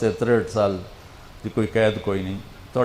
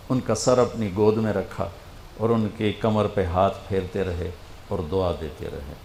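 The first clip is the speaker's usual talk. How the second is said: normal talking voice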